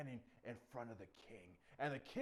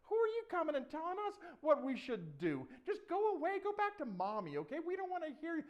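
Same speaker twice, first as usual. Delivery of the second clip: high, loud mocking dismissal; jeering, high-pitched put-down; scornful soldiers; 13 semitones higher, 9 dB louder than usual. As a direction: shoo off the little punk. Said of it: "they're like".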